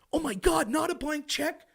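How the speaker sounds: clutch pearls in fake horror; mock horror. Mocking Democrats aghast at no blank check.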